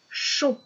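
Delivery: short, lifted, isolated "chon"; syllable alone. Shown as a demonstration. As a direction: present "chon" as the answer. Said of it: "la dernière syllabe du mot cochon".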